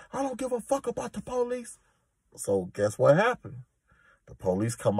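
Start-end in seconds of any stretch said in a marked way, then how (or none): 0.1-1.8 mock yelling. Inmate staging a fake fight for the guards, high mock yell. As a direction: squeal the threats up high like a fake tantrum. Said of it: "He come out yelling and screaming".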